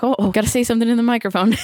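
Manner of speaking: fast, flustered blurt; flustered rush. Retold or imitated. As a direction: rush it out in a panic. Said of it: "I was like".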